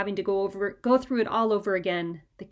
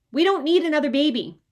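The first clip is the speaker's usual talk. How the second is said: loud protest; 6 semitones higher, 7 dB louder than usual. A narrator's reading. Boy protesting the baby.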